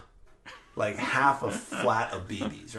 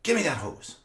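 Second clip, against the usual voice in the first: dad demanding; 6 semitones higher, 5 dB louder than usual. Retold or imitated.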